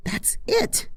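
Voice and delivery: excited discovery. Acted. Spotting the hat in crowd.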